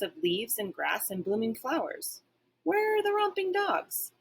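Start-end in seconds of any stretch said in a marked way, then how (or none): 2.6-4.1 puzzled wonder. A narrator's reading. Puzzled dog wondering aloud.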